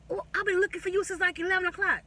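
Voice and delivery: high, quick whiny excuse voice; mocking boyfriend. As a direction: mimic his high innocent excuses. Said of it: "he's like".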